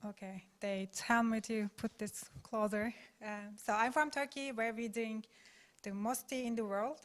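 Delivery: normal talking voice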